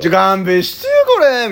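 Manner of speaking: loud, high good-natured complaint; mock protest. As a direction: beg off like the butt of the joke. いじられ役の抗議を再現. (いじられ役の大げさな「勘弁して」)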